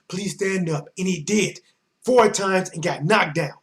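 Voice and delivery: ordinary talk, nothing marked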